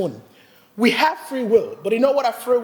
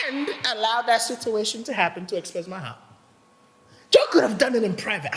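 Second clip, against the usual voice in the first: usual speaking voice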